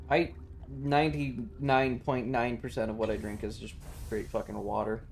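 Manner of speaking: ordinary talk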